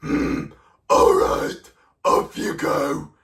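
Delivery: loud rasping, grudging troll; troll reluctantly lets the goat pass; grumbling storybook troll. Acted. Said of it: "the troll was like".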